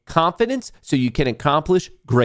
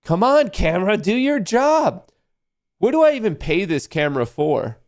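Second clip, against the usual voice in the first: exasperated; 8 semitones higher than usual. Real, not acted. Pleading with camera to focus.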